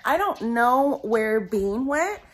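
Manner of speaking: usual speaking voice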